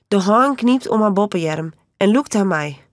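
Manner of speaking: ordinary delivery, nothing marked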